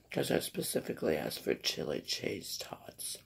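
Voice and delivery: whispering. Real, not acted. Complaining about the wrong order.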